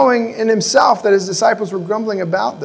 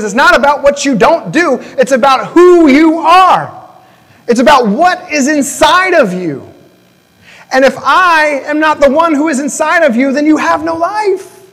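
louder, higher, Jesus declaring who he is; forceful preaching; 6 semitones higher, 4 dB louder than usual. Acted. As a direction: press each line harder, near a shout. Jesus answers the crowd forcefully.

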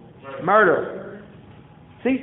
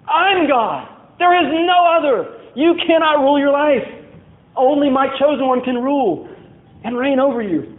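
high, raised voice of sovereign rebuke; thundering divine decree; 6 semitones higher than usual. Acted. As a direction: proclaim forcefully as God overruling rebels. Acted God rebuking the rebels.

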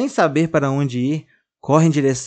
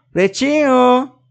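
calling out; 10 semitones higher, 8 dB louder, much slower than usual. Acted. Girl calling for lost doll.

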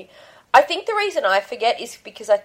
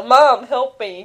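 put-on kid calling mom; 4 semitones higher, 6 dB louder, slower than usual. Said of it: "his first instinct is still".

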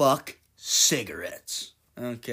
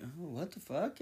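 much softer, puzzled mumble; muttered confusion; 4 semitones higher, 11 dB quieter than usual. Retold or imitated. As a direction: grumble it under your breath, confused. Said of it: "And they're like".

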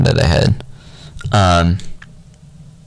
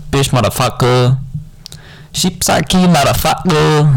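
higher mock Korean accent cursing; 8 semitones higher than usual. Showing off Korean swear words, a mock Korean accent. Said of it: "This is Korean".

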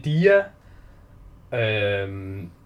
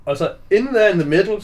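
usual speaking voice

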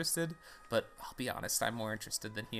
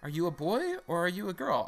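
high, swooping professor voice; put-on higher professor questioning; professor character; 6 semitones higher than usual. Professor asking the new player, put-on high voice. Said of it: "I need a voice for this guy".

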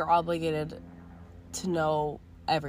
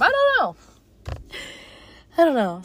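much higher, much louder excited squeal; giddy squeal; 11 semitones higher, 7 dB louder than usual. Real, not acted. Celebrating the last day of school with listeners, giddy squealing outburst. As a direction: burst out giddy and loud.

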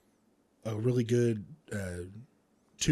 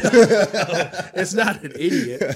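high laughing voice while mocking; cracking up at the accents; laughing hard; 10 semitones higher, 13 dB louder than usual. Real, not acted. A friend cracking up as he mocks East Coast accents, laughing loudly.

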